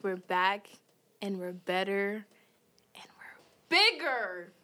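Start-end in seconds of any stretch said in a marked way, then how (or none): 2.9-4.5 breathy dramatic whisper on bigger. Acted. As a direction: whisper it like a big reveal. Dramatic podcast comeback line.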